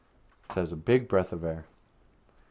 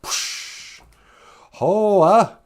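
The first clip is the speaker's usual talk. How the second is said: gasping for air; 10 semitones higher, 7 dB louder, much slower than usual. Acted. Surfacing after a deep dive.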